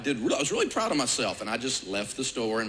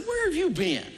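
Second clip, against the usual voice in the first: mock disbelief. His retorted quote to wife.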